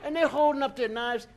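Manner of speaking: usual speaking voice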